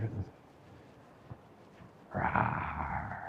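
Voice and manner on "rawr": soft low dragon roar; hushed deep playful rawr. Pretend. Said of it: "Makes me want to do a dragon roar".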